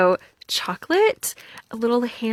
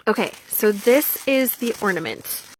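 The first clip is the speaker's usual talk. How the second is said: usual speaking voice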